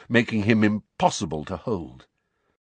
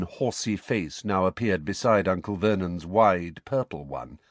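usual speaking voice

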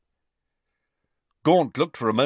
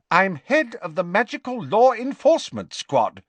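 higher, assertive official; lightly voiced official; 9 semitones higher, 4 dB louder than usual. Official stating his rank to the father, assertive. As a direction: assert your rank formally.

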